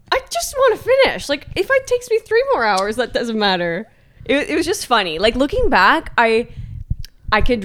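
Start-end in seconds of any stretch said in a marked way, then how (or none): 0.1-3.9 very high, squeaky mock tantrum; angry inner child. Exhausted runner insisting to partner on just finishing, high childish whining. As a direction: whine it like a cross little kid. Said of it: "my inner child was coming out".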